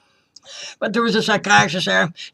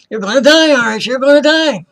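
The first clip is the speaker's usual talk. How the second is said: loud, high, hammering repetition; imitated insistent shouting; 7 semitones higher, 8 dB louder than usual. Woman telling patient he will die, insistent shouting. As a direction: shout it at them as a verdict.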